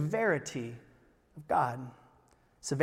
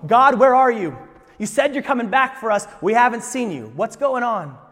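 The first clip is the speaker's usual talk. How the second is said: impatient complaint; 8 semitones higher, 13 dB louder than usual. Retold or imitated. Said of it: "Some go".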